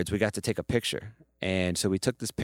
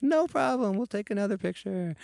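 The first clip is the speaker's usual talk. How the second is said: bright imitation of a beaming first lady, pitched up high; high Michelle Obama voice; 13 semitones higher than usual. Imitating the first lady cheerfully agreeing to another photo, high and sweet. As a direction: chirp it like a super happy Oprah-esque host. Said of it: "So she's like".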